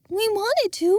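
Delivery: lightly voiced sorry Jenna. Jenna explaining to Envis, apologetic.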